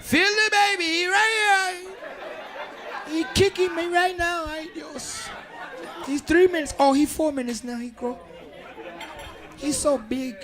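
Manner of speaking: hammed-up pregnant woman feeling the baby; mock pregnant girlfriend. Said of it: "You kiss 'em like".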